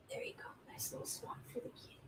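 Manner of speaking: quick soft whisper to a pet. Real, not acted. Placing the kitty in its spot, whispering. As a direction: whisper while settling the kitten. Settling the cat down.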